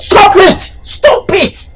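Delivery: high-pitched sharp command; scolding. Acted. Uncle telling them off, scolding.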